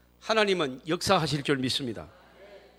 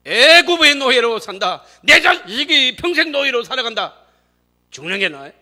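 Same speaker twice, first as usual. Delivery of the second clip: loud complaint; 7 semitones higher, 12 dB louder than usual. Acted. Preacher voicing the complaining Israelites to the congregation, loud complaint. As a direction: cry out the people's despair, high and loud.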